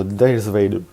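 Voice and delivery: French speaker putting on an English accent for Darth Vader; slow English-accented name. Real, not acted. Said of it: "je l'ai dit avec mon accent anglais".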